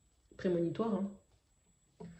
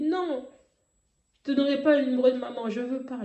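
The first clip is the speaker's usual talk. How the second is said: raised, fast, emphatic refusal; retold cry of refusal; 4 semitones higher, 5 dB louder than usual. Daughter refusing her father in a dream, crying out. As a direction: refuse urgently, voice pitched up. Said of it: "J'ai crié".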